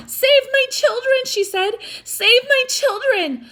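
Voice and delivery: high, urgent plea; pleading mother. Acted. Injured mother begging Hogan to save her children, high and pleading.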